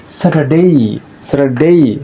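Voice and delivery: স্যাটারডে ending in a clear drawn "ei"; upward glide ending. Shown as a demonstration. Teacher modelling day endings for learners, upward glide ending. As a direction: model স্যাটারডে with an added i-glide on the last syllable. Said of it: "ই যুক্ত করে".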